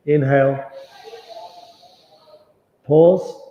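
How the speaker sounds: guided breath cues. Acted.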